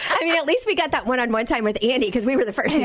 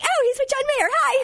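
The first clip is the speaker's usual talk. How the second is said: high, breathless fangirl greeting; high star-struck hi; star-struck squeal; 13 semitones higher than usual. Retold or imitated. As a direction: gush like you just spotted a celebrity.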